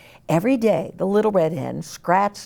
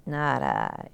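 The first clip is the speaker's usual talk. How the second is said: much lower, softer rat; 6 dB quieter than usual. Acted. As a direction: grumble a refusal like a lazy rat.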